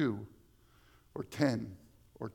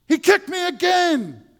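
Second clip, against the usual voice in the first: put-on tattling child; 11 dB louder than usual. Sister tattling in childhood story.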